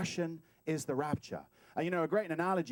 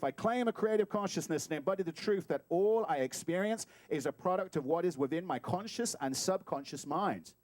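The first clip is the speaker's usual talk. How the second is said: usual speaking voice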